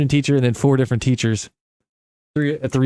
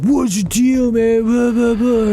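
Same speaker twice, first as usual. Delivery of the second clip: high, mocking whiny voice of the guy who got hit; high mock indignant whine; mock angry guy; 10 semitones higher, slower than usual. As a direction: whine it like an offended kid. Retelling annoyed guy hit by ball.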